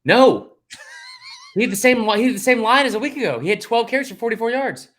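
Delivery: loud, high, indignant outburst; worked-up rebuttal. Real, not acted. Co-host rebutting a claim about Tank Bigsby, worked-up. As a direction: fire back hotly, pitched right up.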